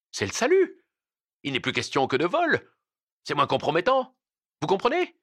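high, excited burst of hope; joyful relief. A narrator's reading. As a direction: seize on the way out with sudden glee. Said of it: "Le juge eut un sursaut joyeux". (sursaut de joie, voix aiguë)

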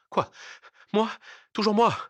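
high, loud outraged questions in short bursts; bristle, short of breath, at being blamed; indignant protest. A narrator's reading. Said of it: "devint pourpre et respira plus péniblement encore". (protestation indignée et suffoquée)

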